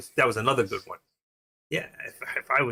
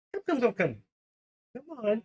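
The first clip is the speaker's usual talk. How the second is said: much higher, softer coaxing call; coaxing pet voice; 10 semitones higher, 4 dB quieter than usual. Real, not acted. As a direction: sweet-talk the animal into coming.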